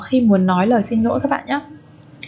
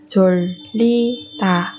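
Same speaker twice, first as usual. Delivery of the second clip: 졸리다 slowly, a pause between syllables; slower than usual. Shown as a demonstration. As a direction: break 졸리다 into separate, drawn-out syllables. Teacher models word syllable by syllable.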